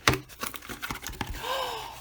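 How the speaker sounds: soft, airy gasp of wonder; a long amazed intake of breath; breathless gasp. Real, not acted. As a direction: gasp in disbelief. Seeing ninety-six pencils.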